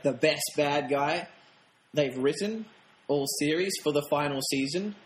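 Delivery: normal talking voice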